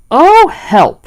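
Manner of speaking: a high, loud cry for help; the stuck bear crying out; alarmed cry. A narrator's reading. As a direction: suddenly realise you're stuck. Pooh stuck in the hole.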